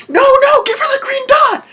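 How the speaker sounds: very high, loud mock shriek; frantic shout. Viewer urging the card reader to work, in a shrieking panic. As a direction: yell at the screen, voice shooting way up. Acts out yelling at the show.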